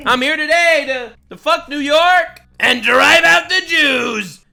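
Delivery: loud, high parody of a rally shouter; mocking mimicry. Commentator parodying a politician at a protest, mocking shout.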